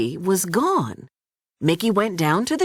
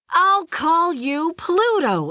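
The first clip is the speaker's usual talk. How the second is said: higher, slow delighted announcement; excited exclaim; 8 semitones higher, slower than usual. A narrator's reading. Mickey naming his new puppy, delighted exclaim. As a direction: savor the new name with joy. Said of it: "exclaimed Mickey".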